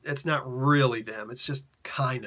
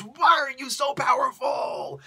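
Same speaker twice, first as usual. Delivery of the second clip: much higher, louder, slow demanding yell; furious shout; 10 semitones higher, 6 dB louder, slower than usual. Retold or imitated. Chief inquisitor yelling at Spawn under torture. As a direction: bellow the question at the prisoner.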